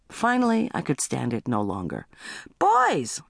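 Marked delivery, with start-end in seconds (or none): 2.6-3.2 put-on high-pitched call to the kids; mother's yell. A narrator's reading. Mother yelling for her boys, high call. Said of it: "I yelled".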